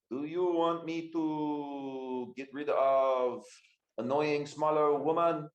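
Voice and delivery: slow, halting catfolk brute voice; slow Thunder voice. Acted. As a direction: drag the words out like a big beast. GM voices Thunder, broken speech.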